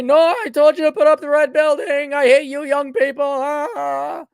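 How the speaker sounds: high, loud mock scolding of an old boss; baby boomer boss impersonation. Boomer boss denying his own order, high cranky scolding. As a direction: scold like a cranky boomer who hates young people. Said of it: "my baby boomer boss impersonation".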